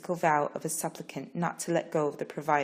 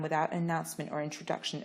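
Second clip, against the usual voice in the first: ordinary delivery, nothing marked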